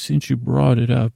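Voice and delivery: ordinary talk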